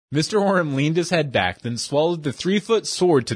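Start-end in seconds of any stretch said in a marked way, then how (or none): none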